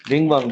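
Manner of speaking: "बिंग बांग" with a voiced b on both words; English-letter misreading. Shown as a demonstration. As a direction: say "बिंग बांग" as an English reader would, with b not p. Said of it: "हम English में जब बोलते हैं बी को". ("बिंग बांग" کو انگریزی حرف ب کے ساتھ بولیں)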